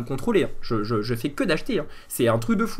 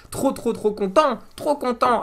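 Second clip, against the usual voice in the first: gushing; 9 semitones higher than usual. Real, not acted. Gushing over new mugs.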